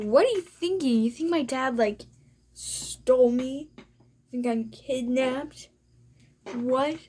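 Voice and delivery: incredulous. Retold or imitated. Girl wondering if woman suspects dad kidnapped her, incredulous. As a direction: act out baffled disbelief, stretching the key words. Acted disbelief at the woman's suspicion.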